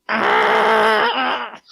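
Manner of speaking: high yelp of pain like a kicked puppy; a howl way up high, like a kicked puppy; pained scream. Retold or imitated. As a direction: let out a sharp, high cry of pain. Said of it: "I'm, like, on the floor going".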